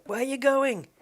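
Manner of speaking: loud, high call to someone down the street; shouting from the window. Acted. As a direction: call it out like a wife from an upstairs window. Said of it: "shouting from the window".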